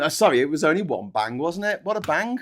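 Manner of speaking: ordinary talk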